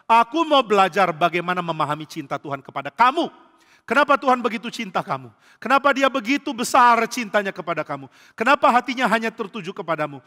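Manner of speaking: amazed angel. Acted. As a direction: marvel aloud at God's love. Malaikat bertanya penuh kagum. (malaikat kagum, lebih tinggi dan keras)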